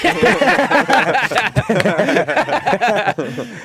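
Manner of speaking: big high burst of laughter; loud delighted laughter. Real, not acted. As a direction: crack up loudly. Laughing at the joke.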